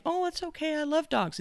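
high breezy mock guest voice; gushing guest. Guest reassuring the flustered owner, high gushing voice. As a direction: play the unbothered guest lightly. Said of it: "the person is saying".

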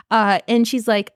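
ordinary talk

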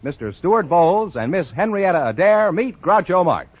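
ordinary talk, nothing marked

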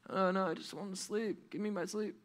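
high, soft drowsy protest; drowsy kid pleading, small voice; sleepy whine. Acted. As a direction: whine softly to be left asleep. Sleepy child refuses.

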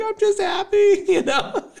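a laughing high-pitched imitation of joyful tears; laughing through happy tears. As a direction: squeak it out through giddy laughter. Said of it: "And she's like".

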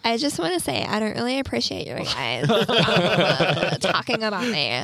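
put-on loud Valley Girl voice with a laugh; Valley Girl accent. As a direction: play the offended Valley Girl. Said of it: "someone flipped the switch".

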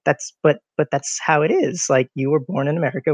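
ordinary talk, nothing marked

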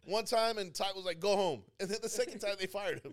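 loud, high, excited storytelling; boisterous teasing. Real, not acted. Coworker ribbing friend about getting fired, loud and boisterous.

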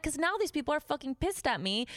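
high, worked-up complaint; arguing back. Retold or imitated. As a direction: replay an indignant quarrel with the boss. Retold argument with the boss.

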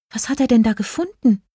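high-pitched, mildly baffled question; puzzled fairy. A narrator's reading. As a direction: ask it puzzled, in a small high voice. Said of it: "fragte Jelina verdutzt".

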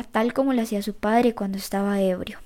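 plain reading voice

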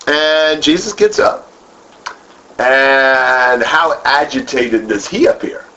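usual speaking voice

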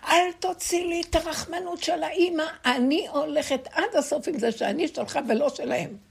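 mother crying out to God in her room; retold crying out. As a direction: cry out to God with full resolve. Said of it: "מצאתי את עצמי צועקת".

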